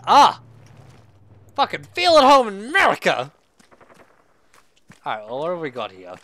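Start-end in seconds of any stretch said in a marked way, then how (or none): 0.1-0.4 short, high, loud cry of alarm; real startled shout; startled yell. Real, not acted. Startled while playing the game. 1.6-3.3 high, loud swearing shout; shouted at full volume; yelled outburst. Real, not acted. Yelling at a sudden game event.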